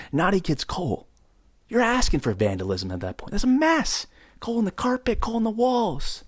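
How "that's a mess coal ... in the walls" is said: exasperated. Real, not acted. Exasperated rant.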